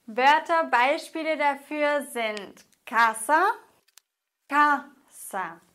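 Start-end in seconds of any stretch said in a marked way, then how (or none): 2.9-3.5 casa stressed on the first syllable, ca, with a rising end; penultimate stress. Shown as a demonstration. Teacher introduces the example, stressing the ca of casa. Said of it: "dann wird die vorletzte Silbe betont". 4.5-5.6 casa split in two, CA stressed, sa falling away; penultimate stress. Shown as a demonstration. Teacher demonstrates the rule, casa stressed on the syllable ca. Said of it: "vorletzte Silbe".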